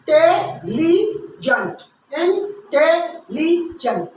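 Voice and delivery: intelligent very slow, syllables pulled apart. Shown as a demonstration. Teacher breaking a word into syllables for students, very slowly. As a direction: spell out intelligent in slow beats. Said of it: "how many syllables are there".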